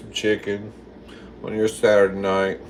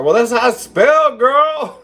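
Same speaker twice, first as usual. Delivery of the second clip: high, loud, protesting outburst; loud defensive exclamation; exclaimed protest; 13 semitones higher, 5 dB louder than usual. Real, not acted. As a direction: protest loudly, pitch jumping way up. Protests after failing to sound it out.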